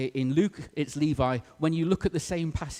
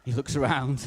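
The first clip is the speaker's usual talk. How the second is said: ordinary talk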